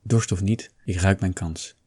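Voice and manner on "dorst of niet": plain reading voice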